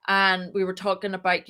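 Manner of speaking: ordinary delivery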